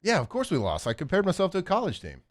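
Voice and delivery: high-pitched sarcastic confession; mockingly obvious, pitch jumping up; mock self-blame. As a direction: play the loser stating the obvious. Mocking quote as losing player.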